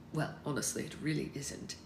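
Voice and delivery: quiet. A narrator's reading. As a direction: say it quietly and dry. Alex agrees quietly.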